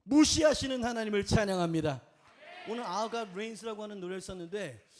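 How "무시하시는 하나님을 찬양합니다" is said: ringing declaration of praise; preacher's loud acclamation; loud proclamation. Real, not acted. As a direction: proclaim it loudly to the congregation.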